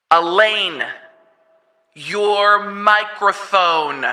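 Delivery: loud, drawn-out megaphone call at Elaine; megaphone yell. Acted. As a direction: call her through a megaphone, stretched slow. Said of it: "I can yell at Elaine".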